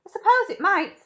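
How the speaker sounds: high, loud, breezy agreement; cheerful. A narrator's reading. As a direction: concede it merrily. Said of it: "cheerful as ever".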